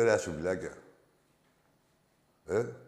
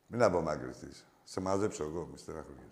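"Μην απομακρυνθείς" said lower and softer, mock soothing; mock reassuring, lower and softer "Μην απομακρυνθείς"; mock tender; 7 semitones lower, 9 dB quieter than usual. Real, not acted. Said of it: "Αλλά μην απομακρυνθείς".

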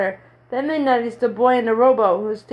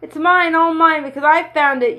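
shouted high and louder, claiming the find; triumphant shout; 6 semitones higher, 4 dB louder than usual. A narrator's reading. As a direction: crow it out as a proud little boy. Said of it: "he began to shout".

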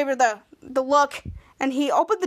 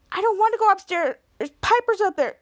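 acted reluctant protest; 7 semitones higher than usual. Boy objects to being sent upstairs.

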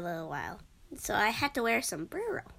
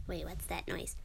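hushed; 5 semitones lower, 10 dB quieter than usual. Real, not acted. Hears a noise, hushes.

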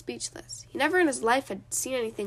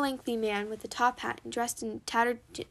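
plain reading voice

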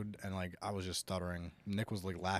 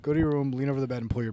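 loud, higher mock scolding; mock stern parent; 5 semitones higher, 9 dB louder, faster than usual. Host jokingly punishing co-host, mock stern parent. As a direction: play the parent ordering punishment.

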